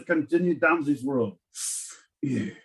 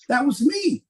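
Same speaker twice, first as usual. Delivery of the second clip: hammed-up Eugene; 8 semitones higher than usual. Playing Eugene bragging.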